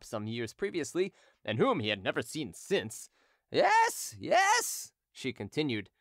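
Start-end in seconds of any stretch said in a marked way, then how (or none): 3.5-4.9 put-on drunken hawker. Drunken hawker taunting Derues before the crowd, high mocking voice. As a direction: taunt him gleefully like a drunk street seller.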